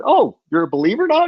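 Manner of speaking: teasing. Retold or imitated. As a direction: rib your friend about Easter. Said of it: "And I said".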